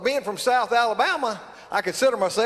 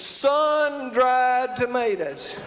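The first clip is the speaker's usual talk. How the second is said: very slowly, with a laugh, Sun-dried tomatoes; drawn-out repeat; much slower than usual. Shown as a demonstration. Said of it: "in South Alabama".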